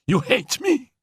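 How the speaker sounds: high accusing cry; cried. Acted. Annette crying out at Félicie, high accusation. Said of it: "cried Annette".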